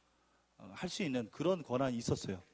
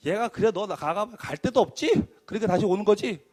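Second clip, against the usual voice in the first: higher, louder sneering boss; mocking boss; 5 semitones higher, 8 dB louder than usual. Imagined boss taunting the returning manager, mocking. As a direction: needle him like a smug boss. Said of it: "딱 이런 생각을 먹을까 봐".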